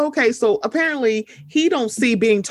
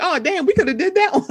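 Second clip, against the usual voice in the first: laughing; 11 semitones higher, faster than usual. Real, not acted. Laughing at missed Reddit story.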